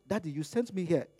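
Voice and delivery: ordinary talk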